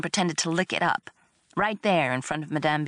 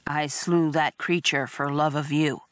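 ordinary delivery

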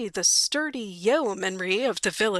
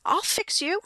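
put-on high little-boy threat; small boy vowing revenge, pitched high; threatening kid; 10 semitones higher than usual. Kid threatens Bill in story.

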